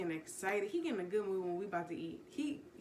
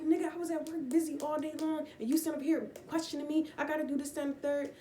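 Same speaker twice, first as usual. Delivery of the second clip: higher mock snappy retort; higher, sassy mock retort; mock angry wife; 8 semitones higher than usual. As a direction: act out the wife snapping back.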